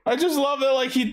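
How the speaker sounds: ordinary delivery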